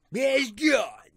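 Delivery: drawn-out trailer voice; dramatic announcer. Pretend. Friend echoes the twist in a hammed-up announcer voice.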